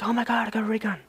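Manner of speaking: high-pitched mock scream, held in volume; retold excited scream. Gamer recalling getting a ray gun, mock screaming. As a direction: squeal it out excitedly without full volume. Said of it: "I was, like, literally screaming".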